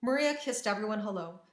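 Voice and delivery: usual speaking voice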